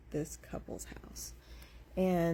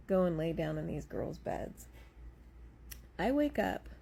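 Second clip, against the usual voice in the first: normal talking voice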